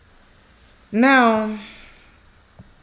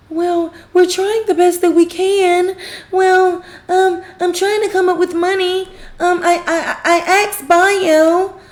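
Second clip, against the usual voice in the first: higher, drawn-out imitation of hedging; mock excuse-making; 8 semitones higher than usual. Retelling her excuse about the money, mock hedging. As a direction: stall through a retold excuse, pitched up.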